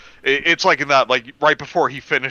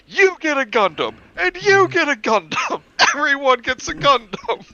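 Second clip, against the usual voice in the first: very high, excited giveaway shout; rally the crowd like a talk-show host handing out prizes; squealing Oprah-style giveaway; Oprah impression; 3 dB louder than usual. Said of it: "like fucking Oprah just came in".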